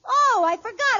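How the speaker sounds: ordinary talk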